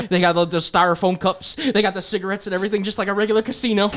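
high, hammed-up Southern coach. Coach selling the recruit a casino, excitable Southern twang. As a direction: pitch it in a put-on Southern accent. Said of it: "most down south college football coach".

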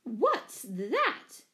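mild storybook screech of horror; startled, affronted screech; screeched question. A narrator's reading. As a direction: screech it in alarm, voice leaping on the words. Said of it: "she screeched".

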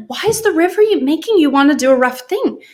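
high baffled question. Retold or imitated. Wife baffled by husband's river remark, high and incredulous. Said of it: "And I was like".

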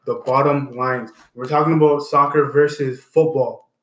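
loud, punchy host comeback after the break; show host announcing. Acted. Host welcoming listeners back, loud announcer energy. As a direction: pump up the listeners like a show host returning on air.

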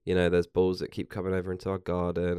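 ordinary delivery

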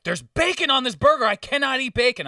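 loud, high-pitched complaint; mock outraged customer. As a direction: imitate an indignant customer kicking up a fuss. Retold customer's angry complaint.

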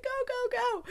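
high, urgent go go go; mock panicked getaway cry. Fleeing with the bag, urging.

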